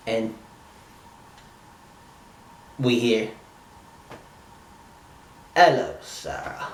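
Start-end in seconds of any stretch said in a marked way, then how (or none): 5.6-6.7 a half-hearted British accent, barely put on; attempted British accent. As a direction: try a British accent you can't quite pull off. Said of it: "I can't do British accent".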